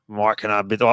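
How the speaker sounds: a loose, half-hearted Belichick impression; a mild mock coach voice with a faint Southern lilt; Bill Belichick voice. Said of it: "I'll try to do my best Bill Belichick voice".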